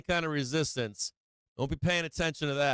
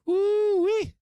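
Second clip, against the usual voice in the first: very high, loud woo-wee; a hollered cheer as the stock rises; gleeful whoop; 7 dB louder than usual. Real, not acted. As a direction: let out a triumphant holler. Cheering NVDA holding the market.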